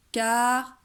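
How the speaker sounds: car and ble loud, ta whispered in Cartable; teacher demonstrating the whispered middle syllable; loud outer syllables. Shown as a demonstration. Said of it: "faites-lui chuchoter la syllabe du milieu".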